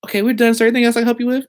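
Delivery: a cheery call-centre rep, imitated; mock phone agent. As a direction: play the agent wrapping up the call. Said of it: "And I was like".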